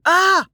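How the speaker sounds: high loud shriek of fright; startled yell. A narrator's reading. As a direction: jump and shriek in shock. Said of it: "Amelia yelled".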